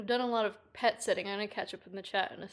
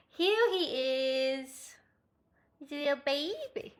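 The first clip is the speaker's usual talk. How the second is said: slow, pitched-up coo; 4 semitones higher than usual. Real, not acted. Pet sitter showing the cat on camera, cooing.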